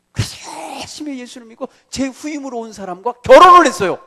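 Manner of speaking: full-voiced pulpit preaching; fervent preaching. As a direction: drive the story home.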